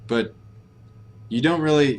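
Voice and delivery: ordinary talk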